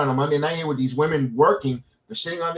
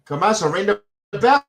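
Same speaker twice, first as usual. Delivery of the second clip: impatient call; 6 semitones higher, 5 dB louder than usual. Real, not acted. Impatient for match to start.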